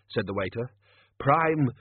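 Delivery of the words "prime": corrective. Acted. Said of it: "the manager corrected him".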